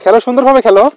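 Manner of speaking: calling out to players far off; yelling across the room. Real, not acted. Calling to boys playing football.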